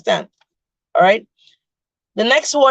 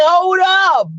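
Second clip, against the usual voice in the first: high, loud "Hold up."; mock shout; 8 semitones higher, 4 dB louder than usual. Daughter telling her mother to stop, mock yell. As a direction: stop her short with a raised voice.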